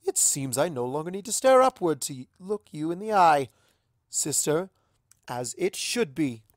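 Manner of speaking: a touch of teasing regality; regal princess. Acted. Luna teasing her older sister, lightly regal.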